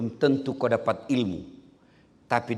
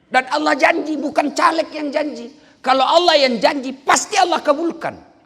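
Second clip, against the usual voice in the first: forceful preaching; 12 semitones higher, 9 dB louder than usual. Real, not acted. Preaching God's promise forcefully.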